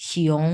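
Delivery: শিয়ং as one gliding syllable ending "ong"; joined "shi-ong". Shown as a demonstration. Teacher showing the joined word, gliding "shyong". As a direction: blend শি and ওং into শিয়ং. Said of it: "এরকম".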